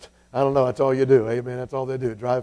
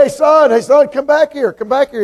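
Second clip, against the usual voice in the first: high, loud call into the distance; hollering; 11 semitones higher, 5 dB louder than usual. Acted. Preacher calling back the boy riding away, hollering. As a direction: holler after someone far off. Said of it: "So he hollered".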